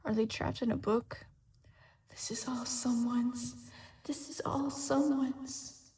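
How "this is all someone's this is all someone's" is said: mysterious voice whispering a clue; eerie whisper. A narrator's reading. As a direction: echo it like a memory. Echoing mysterious voice again.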